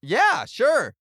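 high, loud shouted reply; calling out. Acted. Player answering the giant golem's bargain, loud high call.

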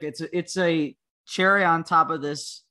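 usual speaking voice

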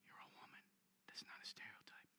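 whispered aside. Real, not acted. Aside to audience.

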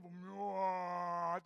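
long drawn-out monster moan; hammed-up scary groan; mock monster noise. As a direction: moan like a pretend bogeyman. Imitates a scary dark figure.

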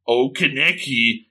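slow, drawn-out ghoul purr; creepy ghoul. Acted. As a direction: stretch the words, savouring the prey. Ghoul woman savours her victim's panic.

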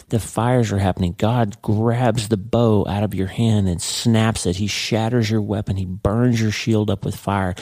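ordinary talk

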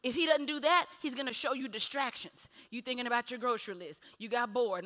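usual speaking voice

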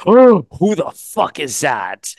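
slow, drawn-out amazed gasp of a line; stunned and slow, savoring each word; awestruck reaction. Retold or imitated. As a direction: act out a kid's stunned first sight, stretching it out. Acts out childhood awestruck reaction.

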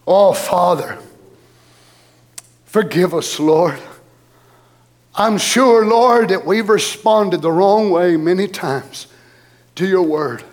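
real congregational prayer, slow and subdued; earnest prayer. Real, not acted. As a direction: plead humbly with God, unhurried, with pauses.

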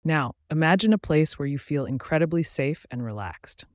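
measured guided-visualization delivery with long pauses; guided meditation. Acted. Host guiding listeners into a relaxing visualization, calm meditation voice. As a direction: guide the listener gently through each image, pausing between steps.

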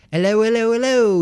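high, singsong fake British greeting; bad British accent. Guy answering the phone at his party, bad British accent. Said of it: "super shitty British accent".

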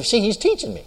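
normal talking voice

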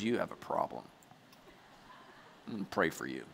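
low, hushed mock pity; mock concern. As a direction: drop low and quiet, as if concerned. Mock pity for other parents' failings.

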